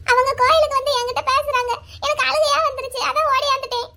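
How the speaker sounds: high-pitched, sobbing confession; high voice breaking into small sobs; tearful. Acted. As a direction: explain yourself through tears. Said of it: "எதுக்கு இங்க உட்காந்து அழுதுகிட்டு இருக்க".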